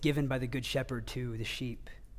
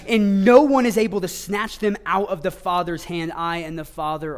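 scripture proclaimed from the pulpit, louder; forceful preaching; 6 semitones higher, 13 dB louder than usual. Acted. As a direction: read the promise out strong and louder.

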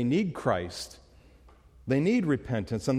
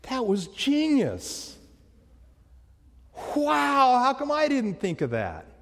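amazed admiration; 11 semitones higher than usual. Retold or imitated. Boss marveling at the swindle, amazed. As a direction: gush over a clever trick. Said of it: "He's like".

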